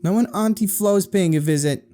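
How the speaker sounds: high-pitched, cross retort; snippy high sibling voice; irate sister. A narrator's reading. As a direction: call it out irritably. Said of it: "his irate sibling called out".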